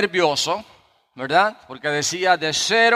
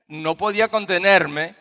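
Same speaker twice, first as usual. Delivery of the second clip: ordinary talk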